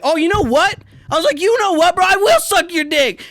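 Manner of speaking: high, loud, hammed-up offer; mock surrender. To the bully, faking eager surrender: high, loud and hammy. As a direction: throw up your hands and overplay it. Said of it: "And I was just like".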